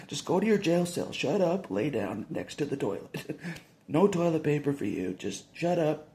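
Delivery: loud, high barked orders; mocking jail guards. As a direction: boss the prisoner around like a guard.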